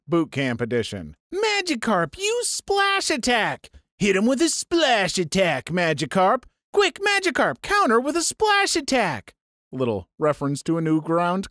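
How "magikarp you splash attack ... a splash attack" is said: high-pitched cartoon battle trainer voice; frantic trainer. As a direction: call out attacks in a put-on high voice, dipping in the middle.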